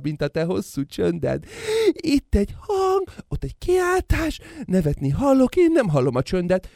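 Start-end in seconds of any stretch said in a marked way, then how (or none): 1.9-6.7 very high exalted voice; ecstatic cry. Acted. Son exalting over the sounds, very high. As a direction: cry out in rapture.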